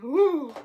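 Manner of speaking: a high, loud panicky "ooh"; panicky voice squeak. Retold or imitated. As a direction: let the voice crack into a panicked "ooh". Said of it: "my voice starts going".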